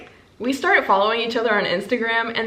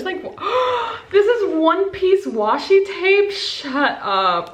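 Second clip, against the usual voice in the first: can't believe what she just found; delighted surprise; 10 semitones higher, slower than usual. Real, not acted. Unboxing Gavin's gift, amazed and delighted to find the tape.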